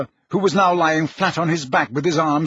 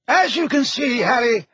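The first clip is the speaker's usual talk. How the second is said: put-on higher, genial old wizard; lightly voiced old wizard; 8 semitones higher than usual. A narrator's reading. Headmaster explaining calmly to Harry. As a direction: speak with dry amusement.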